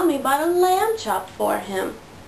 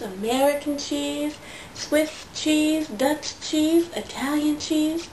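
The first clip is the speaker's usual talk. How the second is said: ordinary delivery, nothing marked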